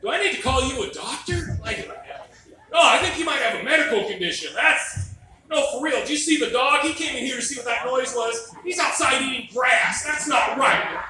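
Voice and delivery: high, loud mock astonishment at a gross eater; excited teasing. Real, not acted. Comic teasing someone in the crowd, loud and astonished. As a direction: rib the eater with loud, laughing disbelief.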